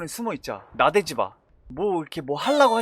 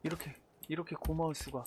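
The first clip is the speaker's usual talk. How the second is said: much softer, stretching 고마울; quiet grateful sigh; 14 dB quieter, faster than usual. Real, not acted. Grateful whisper over found bandages. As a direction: breathe out thanks.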